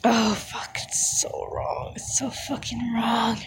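breathy ASMR whisper, close to the mic; intimate whisper. Acted. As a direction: whisper it like a secret in his ear.

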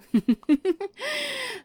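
high, loud gleeful giggle; wicked giggle. Acted. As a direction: laugh at your own rough threat. Laugh after rough promise.

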